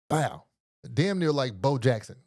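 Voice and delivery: normal talking voice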